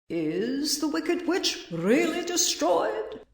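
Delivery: high, slow grand voice with a touch of fear; slightly trembling. A narrator's reading. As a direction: draw it out, a little unnerved. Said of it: "Dorothy thought it trembled a little".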